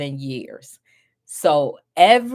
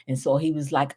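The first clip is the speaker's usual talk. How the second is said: ordinary delivery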